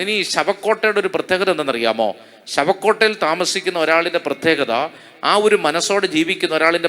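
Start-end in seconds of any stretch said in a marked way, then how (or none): none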